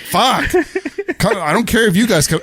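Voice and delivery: hammed-up irritation; mock-frustrated Michael Myers. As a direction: toss it out as a playful fed-up grumble. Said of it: "He's like".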